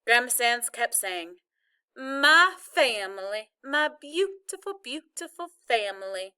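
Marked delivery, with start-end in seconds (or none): 1.9-6.3 put-on weepy Southern accent. A narrator's reading. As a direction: overcome grandma with a Southern accent. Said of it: "that Southern style accent and all that weeping".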